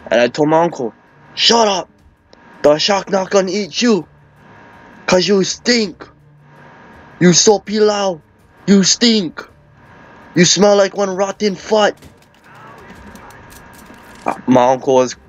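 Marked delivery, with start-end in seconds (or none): 1.3-12.0 higher-pitched, indignant telling-off; mock scolding. Nephew telling his uncle that he stinks, mock scolding.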